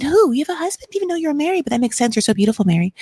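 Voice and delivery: high, gushing mock surprise; fake sweet Robin. Robin flattering Mary, high and gushing. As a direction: overplay the flattering innocent. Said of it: "that entire reenactment".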